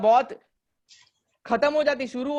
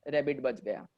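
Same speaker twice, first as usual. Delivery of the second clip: ordinary talk, nothing marked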